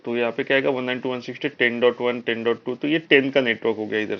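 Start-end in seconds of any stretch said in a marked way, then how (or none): none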